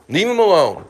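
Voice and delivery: loud, high shout; shouting at a dog. Real, not acted. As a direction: tell the dog off loudly. Yelling at the dog.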